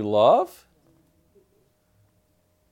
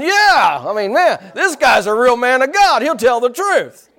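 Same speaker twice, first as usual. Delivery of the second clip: high, loud excited kid impression; imitating a boy's enthusiastic agreement; little boy chiming in; 9 semitones higher, 6 dB louder than usual. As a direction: pipe up eagerly as a little boy. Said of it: "the little boy chimes in".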